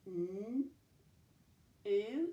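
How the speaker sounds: a hummed N, then a held OO; sounded out. Shown as a demonstration. Teacher showing two sounds in a syllable, sounded out. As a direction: pull NEW apart into N and OO. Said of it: "Two sounds".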